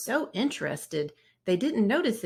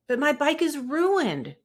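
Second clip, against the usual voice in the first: high, louder upset wail; cried out; 9 semitones higher, 5 dB louder than usual. Acted. Brother protesting to Papa about his ruined bike, upset. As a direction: plead in distress. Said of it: "cried brother".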